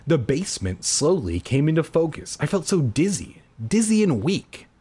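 usual speaking voice

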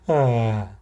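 usual speaking voice

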